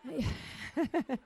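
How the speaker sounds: high, breaking-out giggle; laugh. Real, not acted. Laughing at her own toilet story, high giggle.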